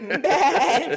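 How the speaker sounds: high, loud mock goat cry; goat bleat. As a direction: bleat the word out loud, playfully. Sea goat bit, playful bleat.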